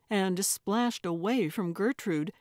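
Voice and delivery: usual speaking voice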